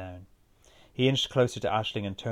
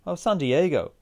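put-on higher, louder American accent; 7 semitones higher, 6 dB louder than usual. A narrator's reading. Aoife telling where she played on holiday, American accent. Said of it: "she said with an American accent".

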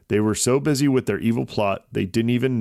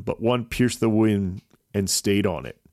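ordinary delivery, nothing marked

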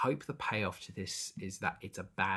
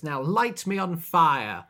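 loud, slow, high mock proclamation; hammed-up zealot giving an order; grand command; 7 semitones higher, 10 dB louder, slower than usual. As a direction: proclaim it to the whole tribe. Acted priest commanding the tribe.

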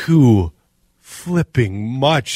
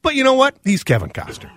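ordinary delivery

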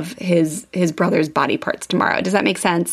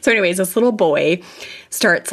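ordinary talk

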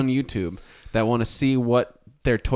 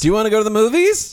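excited; 9 semitones higher, 7 dB louder, faster than usual. Pretend. Prompted new bit.

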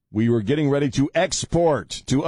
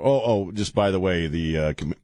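normal talking voice

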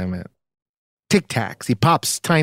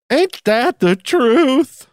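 sassy drawl; 11 semitones higher, 4 dB louder, much slower than usual. Pretend. Mock sassy catchphrase.

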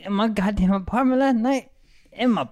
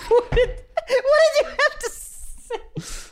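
high, slow words squeezed through laughing; can barely get it out for laughing; helpless laughter; much slower than usual. Real, not acted. Cracking up at the table.

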